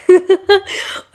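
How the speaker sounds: a real amused chuckle; amused laugh. Real, not acted. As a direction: laugh at your own anecdote. Laughs at the retold tea joke.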